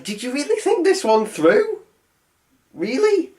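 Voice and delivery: high-pitched incredulous questioning; mock disbelief. Reviewer challenges the sorcerer's plan, mock disbelief.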